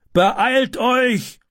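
very high, urgent cry; calling out. A narrator's reading. Neighbour rallies helpers at the fire, calling out. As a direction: call out in a strained high voice to hurry people. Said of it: "rief er".

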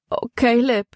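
a lightly voiced girl's wail; a touch of lament. A narrator's reading. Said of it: "jammerte Libby".

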